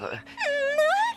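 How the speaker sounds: sudden high startled no. Acted. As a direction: jump at the question. Startled outburst, much higher. (non surpris et aigu)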